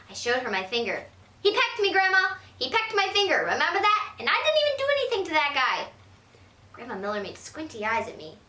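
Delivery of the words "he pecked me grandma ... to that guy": put-on higher, indignant kid voice; aggrieved little girl. Girl telling grandma the bird pecked her, high and indignant. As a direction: tattle like a wronged kid. Child complaining about bird.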